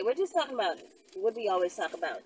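ordinary talk, nothing marked

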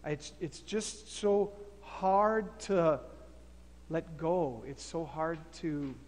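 soft, low, sighing struggle; defeated and torn. Acted. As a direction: barely get the words out. Philemon gives in, struggling.

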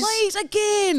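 very high, drawn-out plea; slow, wheedling child's begging; begging kid. Acted. Acting a child begging parents.

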